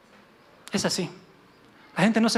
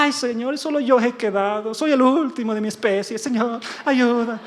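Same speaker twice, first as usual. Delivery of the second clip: hammed-up plaintive plea, dragging at the end; mock dramatic self-pity; 4 semitones higher than usual. Elías complaining to the Señor he is alone, mock dramatic self-pity. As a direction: play the overwrought victim. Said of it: "gente tan dramática, exagerada".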